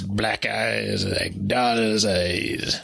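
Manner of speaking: put-on low, slow Quint accent; slow, low Quint accent impression. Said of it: "the Quint accent".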